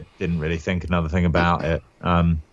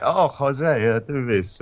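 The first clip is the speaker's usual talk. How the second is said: hammed-up Spanglish accent, pitched up at the start; mock Spanglish accent; 5 semitones higher, slower than usual. Spanglish accent put on to answer the casting director's Dornish request. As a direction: toss off a cheesy Spanglish accent, tongue in cheek. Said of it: "put on my best Spanglish".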